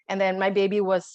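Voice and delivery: normal talking voice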